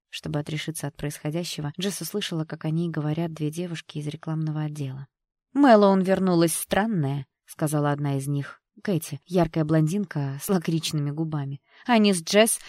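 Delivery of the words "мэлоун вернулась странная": raised, slow, loaded gossip line; catty gossip. A narrator's reading. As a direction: dish it out loud and slow. Gossiping office girl voiced in story.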